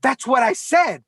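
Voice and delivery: insist, saying Brad as "bread", South African-style; South African accent. Retold or imitated. South African mother insisting on her baby's name, South African accent. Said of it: "the accent that she was speaking in".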